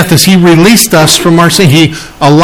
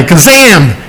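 usual speaking voice

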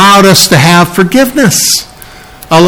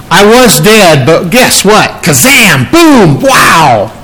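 building to a joyful shout on Kazam and Boom; excited shout; 3 semitones higher, slower than usual. Acted. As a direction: proclaim it like a comic-book blast. Preacher acts out the resurrection.

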